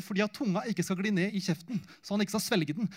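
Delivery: ordinary talk, nothing marked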